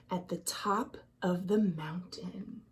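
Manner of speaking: hushed, breathless whisper; spooky whisper. A narrator's reading. Narrator whispering about the ghoul's cave. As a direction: whisper it like a secret.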